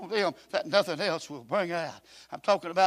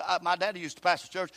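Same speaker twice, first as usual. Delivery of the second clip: normal talking voice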